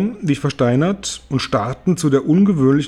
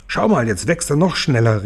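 usual speaking voice

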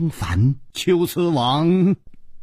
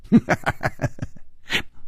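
soft, breath-only chuckle; beaming chuckle; 6 semitones higher, 5 dB quieter than usual. A narrator's reading. Situ Liuxing breaks into a smile before answering, chuckling softly. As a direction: let out a light, quiet run of laughter. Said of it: "展颜一笑".

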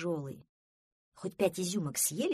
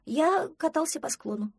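put-on higher, slightly louder young creature; reply as a lightly voiced duckling; 9 semitones higher, 4 dB louder than usual. Duckling answers Простодурсон about getting dirty, lightly voiced.

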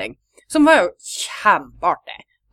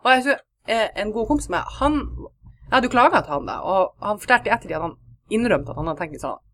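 ordinary talk, nothing marked

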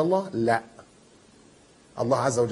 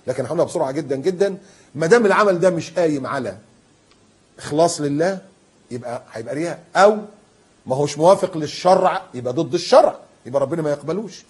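ordinary talk, nothing marked

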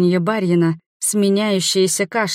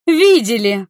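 louder, higher furious echo; yelling; 4 semitones higher, 5 dB louder, slower than usual. A narrator's reading. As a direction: throw the word back in a yell. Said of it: "заорала я".

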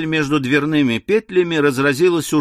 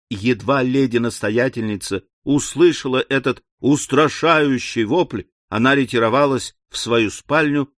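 ordinary delivery, nothing marked

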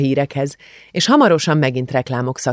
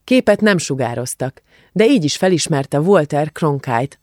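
ordinary delivery

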